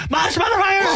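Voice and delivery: very high screaming rant; Sam Kinison scream. Pretend. On request, redoing the Kinison scream at full pitch. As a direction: shriek it like Kinison's trademark yell. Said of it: "Do that again".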